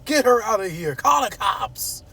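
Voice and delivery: high-pitched mock shouting; high, urgent order to throw her out; Martin. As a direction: yell it in a high, alarmed impression. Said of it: "That's how he talk".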